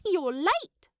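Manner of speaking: put-on a prim young hobbit chiding lightly; high crisp voice of a proper hobbit lass; Primula. Primula greets Thorin.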